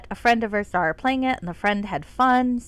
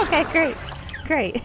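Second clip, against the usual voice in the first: ordinary delivery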